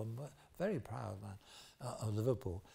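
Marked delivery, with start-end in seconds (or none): none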